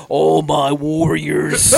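drawn-out, slow villain call; villain gang leader. Retold or imitated. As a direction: call out to his gang slowly. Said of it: "he's like".

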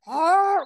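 loud growling roar imitating a bear; mock bear roar. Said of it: "this bear's like".

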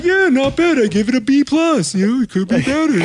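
imitating Rel grading the mix a B plus; mock critic. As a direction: rate it like a picky teacher. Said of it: "he's like".

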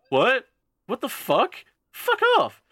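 high-pitched mock outrage; shocked disbelief. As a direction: play it incredulous, jumping up high. Retold shocked reply to an offer.

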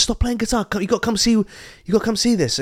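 high-pitched acted pestering; nagging cousin. Voicing cousin nagging Carlos to come.

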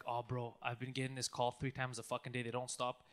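low, quiet grumble of a friend; imitating weary friend. Friend in the car about scam calls, low weary grumble. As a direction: drop low and soft, imitating him. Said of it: "he's like".

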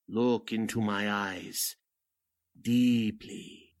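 low, slow, drawn-out hypnotic command; sinister mesmerist, fading to a hush; hypnotist. Acted. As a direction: lull her under with each word. Hypnotizing Miss Poole.

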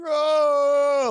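put-on high-pitched child's cry, drawn out; mock devastated wail. Dad imitating his daughter refused a bear, mock wail. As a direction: wail as if it's the end of your life. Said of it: "like, it's the end of her life".

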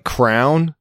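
"crown" for crayon, one syllable; local pronunciation. Shown as a demonstration. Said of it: "hyper-specific way of talking".

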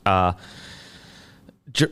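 normal talking voice

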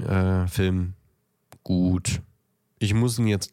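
ordinary delivery

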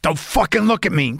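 mock menace, like Dennis Hopper with a gas mask; playful gangster cat voice; Dennis Hopper impression. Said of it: "Dennis Hopper in Blue Velvet".

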